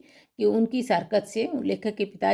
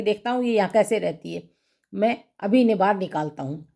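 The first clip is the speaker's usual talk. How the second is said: ordinary delivery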